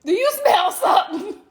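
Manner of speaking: high, suspicious husband; quoting husband. Retold or imitated. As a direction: sniff out the question. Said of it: "He be like".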